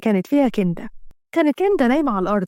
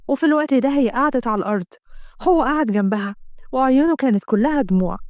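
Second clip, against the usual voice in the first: ordinary delivery, nothing marked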